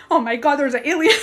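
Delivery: fast, high laughing alien alarm; laughing panic. Retold or imitated. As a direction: laugh at your own fear. Laughing at her own panic.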